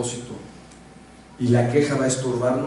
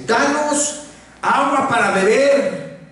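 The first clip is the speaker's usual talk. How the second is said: high, slow, whining plea; whiny complaining crowd; 9 semitones higher, 4 dB louder, much slower than usual. Retold or imitated. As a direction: moan it like a thirsty crowd. Quejas del pueblo en sermón. (pueblo quejumbroso, agudo y lento)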